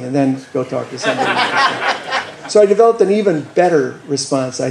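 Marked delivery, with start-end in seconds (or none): 1.4-2.4 quick breathy laugh; short laugh. Real, not acted. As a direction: let a short laugh slip out. Laugh after punchline.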